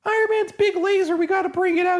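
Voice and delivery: put-on very high-pitched child voice; little kid at play. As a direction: squeal it like an excited little boy. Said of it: "the little kid's like".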